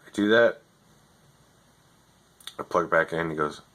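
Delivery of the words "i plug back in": ordinary talk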